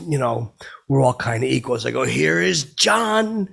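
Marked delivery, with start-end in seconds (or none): 2.0-3.5 slow, high drawn-out announcer call; announcer intro. Retold or imitated. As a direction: draw every word out like the show intro. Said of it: "like that".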